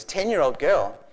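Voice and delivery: normal talking voice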